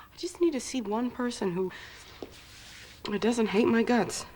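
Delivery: usual speaking voice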